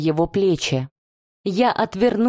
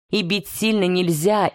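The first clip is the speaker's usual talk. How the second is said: plain reading voice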